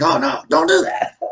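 very high, loud mock yell; high-pitched playful outburst; panicked movie heckle. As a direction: shriek it at the movie screen in mock alarm. Mimics shouting at movie screen.

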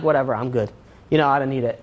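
low, softly tossed-off brush-off; dismissive. Acted. Acts someone shrugging off the diamonds.

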